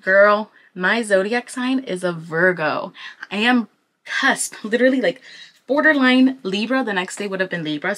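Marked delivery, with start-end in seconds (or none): none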